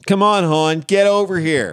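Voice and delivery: loud, high mock Baltimore come-on; hammed-up Baltimore local calling hon; Baltimore accent. Hosts mocking a school board Zoom, in a hammed-up Baltimore accent.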